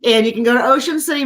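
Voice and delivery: ordinary talk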